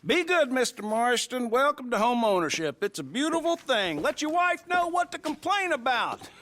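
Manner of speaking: high, hearty farewell building upward; banker's showy congratulations, rising to a high call; jovial send-off. Acted. Banker sends off new homeowner.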